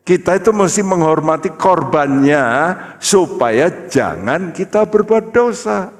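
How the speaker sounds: high, rising preacher's voice; emphatic preaching. Real, not acted. Preaches at full force. (khotbah berapi-api dengan nada meninggi)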